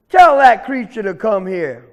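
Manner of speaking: loud bully's order; angry command. Acted. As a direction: order it like an offended alligator. Offended alligator orders rabbit.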